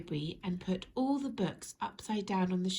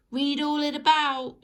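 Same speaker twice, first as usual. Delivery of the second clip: loud, higher street-seller cry; newspaper seller shout; 7 semitones higher, 10 dB louder than usual. Acted. Paperboy hawking the news to the street, shouted. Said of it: "shouted the newspaper sellers".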